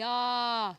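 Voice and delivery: a loud sharp "ah" of pain from a fall; pained cry. Retold or imitated. Boy trips on a stone, cries out loud in pain. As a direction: cry out as if you just tripped and hit the ground. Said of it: "he expressed loudly".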